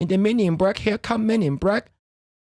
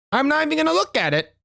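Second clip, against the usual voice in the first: indignant refusal; 9 semitones higher, faster than usual. Real, not acted. Refusing the gift.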